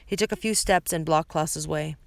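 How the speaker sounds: ordinary delivery, nothing marked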